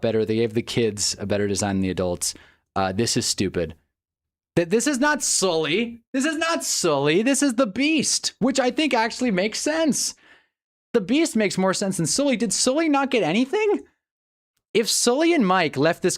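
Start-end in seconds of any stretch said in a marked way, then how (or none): 4.6-16.2 high-pitched outrage. Real, not acted. Fan upset Sully is missing, high squeaky protest. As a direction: squeak it out, genuinely put out.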